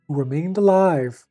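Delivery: ordinary delivery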